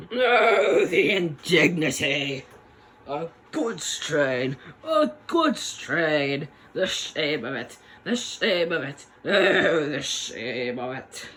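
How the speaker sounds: hammed-up, slow wail of a proud engine insulted; Gordon impression. Gordon forced to pull a goods train, wailing in theatrical indignation. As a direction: moan it like a pompous engine deeply offended, dragging the words. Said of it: "that's my Gordon impression".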